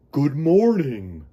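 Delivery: slow, loud, booming friendly whale greeting; a big gentle whale saying hello; lightly voiced booming whale; deep rumbling whale voice. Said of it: "a deep voice rumbled".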